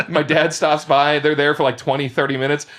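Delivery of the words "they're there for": usual speaking voice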